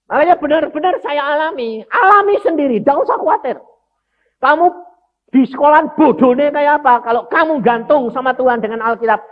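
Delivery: full-force preacher; preaching at full force. Acted. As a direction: drive the point home to the congregation. Preaching own testimony.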